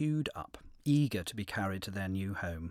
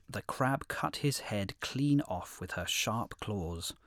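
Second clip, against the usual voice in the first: ordinary delivery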